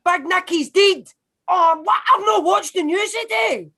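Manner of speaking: high-pitched Scottish alarm; shocked disbelief. Acted. Scot hearing their leader is dead, high shocked disbelief. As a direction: cry out at dreadful news, pitch jumping up. Shocked by supposed death news.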